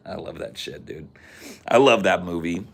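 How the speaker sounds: ordinary talk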